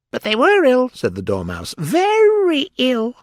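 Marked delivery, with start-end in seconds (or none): 0.1-0.9 very high, loud little creature; Dormouse voice. A narrator's reading. Dormouse voice insisting. 1.8-3.1 very high, slow, drawn-out squeak; emphatic Dormouse. A narrator's reading.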